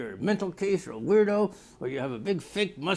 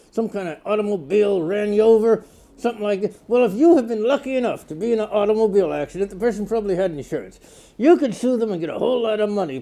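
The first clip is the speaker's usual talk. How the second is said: loud hard-sell commercial voice; TV ad read; 6 dB louder than usual. Acted. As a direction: pitch it like a late-night lawyer ad. Lawyer TV ad read.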